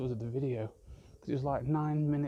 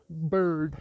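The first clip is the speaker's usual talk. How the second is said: Bambi voice; 3 semitones higher, 7 dB louder than usual. Pretend. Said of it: "Just got Bambi back in my head".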